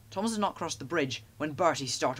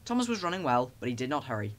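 plain reading voice

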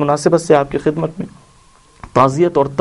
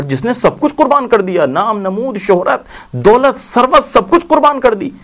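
impassioned preaching; 9 semitones higher than usual. Acted. Preacher to mourners, rising in full-force praise. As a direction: lift the congregation, rising with each word.

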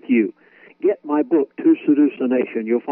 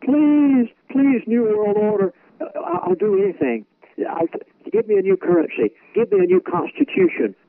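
desperate pleading; 12 semitones higher than usual. Pretend. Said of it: "and you'll say".

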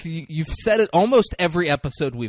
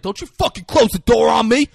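raised, higher acted yell; angry shout; 5 semitones higher, 5 dB louder than usual. Man as she closes the door on him, angry shout. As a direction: shout it at someone shutting you out.

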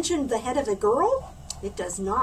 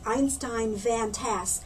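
usual speaking voice

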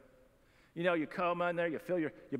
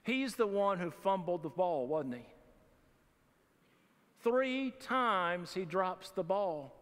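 ordinary delivery

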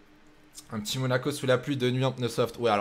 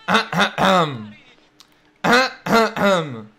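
mock throat clearing; 5 semitones higher, 9 dB louder, slower than usual. Real, not acted. Throat-clearing to call out Elliot.

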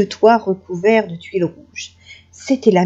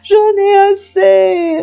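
high, drawn-out comic wail; sobbing wolf; 10 semitones higher, 5 dB louder, much slower than usual. A narrator's reading. Wolf complaining to grandmother, hammed-up sobbing wail. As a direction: bawl slowly between hiccups, loud and high. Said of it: "répondit le loup entre deux hoquets".

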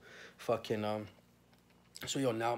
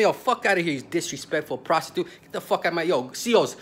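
louder, higher furious order to the guards; angry shout; 4 semitones higher, 6 dB louder than usual. Retold or imitated. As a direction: bark at the guards to end the visit. Ordering guards to end visit.

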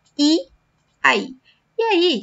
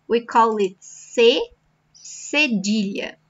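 ordinary talk, nothing marked

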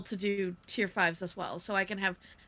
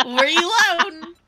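laughing; 8 semitones higher, 8 dB louder, much slower than usual. Pretend. Echoing the reload callout, laughing.

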